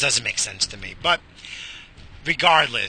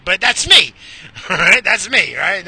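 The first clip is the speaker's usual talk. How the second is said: laughing it off, high and loud; 8 semitones higher, 11 dB louder than usual. Real, not acted. Laughing at himself about his habits to listeners. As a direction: laugh through the admission.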